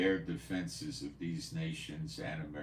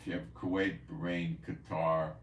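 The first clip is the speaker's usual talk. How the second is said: ordinary talk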